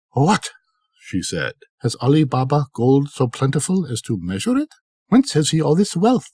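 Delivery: a lightly played jealous woman; envious wife. Kasim's wife finding gold on the measure, envious surprise.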